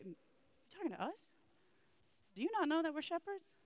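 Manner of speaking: high, amazed disbelief; incredulous shepherds. Acted. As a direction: ask it like you can't believe it's meant for you. Shepherds doubting the angel.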